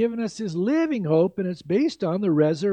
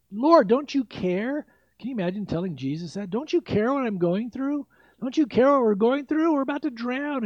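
higher, pleading cry of scared disciples; panicked disciples; 6 semitones higher than usual. Retold or imitated. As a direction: beg Jesus as if the boat is sinking.

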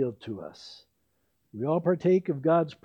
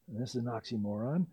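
usual speaking voice

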